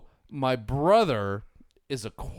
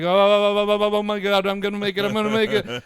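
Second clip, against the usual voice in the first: higher, louder breathless hope; excited panic; 8 semitones higher, 7 dB louder, much faster than usual. Retold or imitated. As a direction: gasp it out as you wobble at speed. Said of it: "I'm like".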